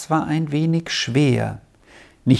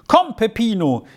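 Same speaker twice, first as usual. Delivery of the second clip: put-on higher-pitched boy's call; 8 semitones higher than usual. A narrator's reading. Boy in the crowd to his friend Peppino, calling out. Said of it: "rief ihm ein Junge entgegen".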